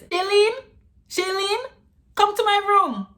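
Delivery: high, loud mom calling her daughter. Retold or imitated. Mom calls her daughter to her room, high calling voice. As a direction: call out to your daughter from the bedroom. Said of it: "shouting out loud to come to her room".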